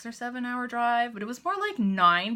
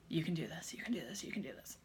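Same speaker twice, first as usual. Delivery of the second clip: imitating an anxious whispered pep talk; whispered self-coaching; 4 semitones lower, 12 dB quieter, faster than usual. Grounding herself in a crowded store, whispered self-reassurance. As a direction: whisper it to yourself like a calming mantra.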